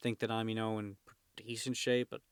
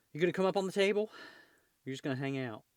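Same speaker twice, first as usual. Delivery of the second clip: high, louder pet-talk voice; talking to a cat; 5 semitones higher, 4 dB louder, faster than usual. Real, not acted. Owner asks the climbing cat to join them, pet-talk voice. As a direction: coax the cat in a raised pitch.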